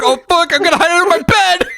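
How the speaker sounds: high-pitched, rushed, scared retelling; mock panic. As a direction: imitate someone frantically scrambling to hide. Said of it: "just scared".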